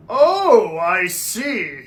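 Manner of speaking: ordinary delivery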